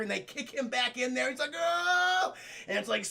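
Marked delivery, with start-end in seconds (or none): 1.5-2.3 long high wailing "oh"; mock cry of distress. Villain kicked back into the chair, mock high wail. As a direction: let a high "oh" climb in mock alarm.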